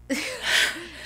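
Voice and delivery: high laugh. Real, not acted. Laughing at joust idea.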